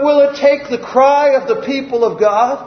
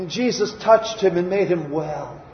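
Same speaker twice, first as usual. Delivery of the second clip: ordinary delivery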